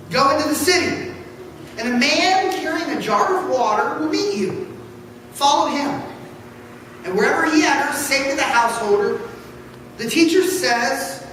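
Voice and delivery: ordinary talk, nothing marked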